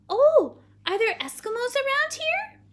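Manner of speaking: high, loud, naive question; wide-eyed little girl. Acted. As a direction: ask like an amazed, gullible child. Child takes sarcasm seriously.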